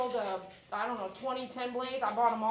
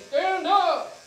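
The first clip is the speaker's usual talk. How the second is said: loud barked command; drill sergeant voice; 6 semitones higher, 12 dB louder than usual. Shown as a demonstration. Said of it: "Everyone say".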